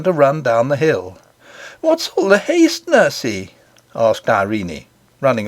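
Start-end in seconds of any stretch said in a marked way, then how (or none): 1.8-3.5 put-on high, light child's voice calling to her nurse; little princess voice. A narrator's reading. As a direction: voice the young princess, higher and eager. Child asks nurse while running.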